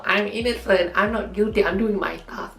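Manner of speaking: high, pleading mock defence; pleading innocence. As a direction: plead like an accused crewmate. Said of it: "who, you know".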